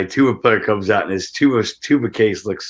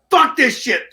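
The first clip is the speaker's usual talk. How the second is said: fed-up barback; 3 dB louder than usual. Retold or imitated. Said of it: "And he's like".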